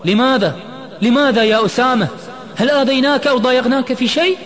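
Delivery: very high, loud desperate questions; frantic shouting. A narrator's reading. Imad pleads with departing Osama, frantic shout. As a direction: shout as if beside yourself. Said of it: "صرخ عماد دون وعي".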